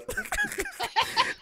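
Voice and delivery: high wheezing squeal of laughter; a helpless high squeal. Real, not acted. Host squeals with laughter after Eddie Curry joins the chat.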